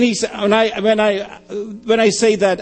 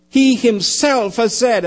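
"He himself" high and deliberate; stress on HE HIMSELF; 6 semitones higher, 3 dB louder, slower than usual. Shown as a demonstration. Preacher explaining what the Greek says, emphatic. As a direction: hit "He himself" slowly and high. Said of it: "The emphasis on who is making the statement".

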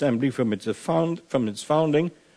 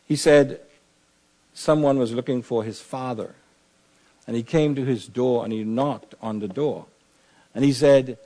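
ordinary talk, nothing marked

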